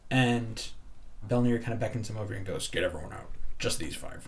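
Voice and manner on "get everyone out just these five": low stern order. Acted. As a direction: keep it low and firm, an order. Belnir orders bouncer.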